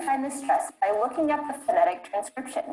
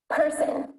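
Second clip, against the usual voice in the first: "Person" with a higher, strong first syllable; overpronounced stress; 4 semitones higher than usual. Shown as a demonstration. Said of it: "try to overpronounce the stress".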